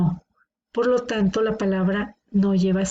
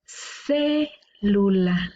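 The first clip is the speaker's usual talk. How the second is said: "Célula" with a long CÉ, a break, then lula; stressed syllable shown; much slower than usual. Shown as a demonstration. Teacher locating the tonic syllable, drawn-out CÉ in "Célula". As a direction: hold CÉ of "Célula" apart from the rest. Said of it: "La sílaba tónica está en la antepenúltima".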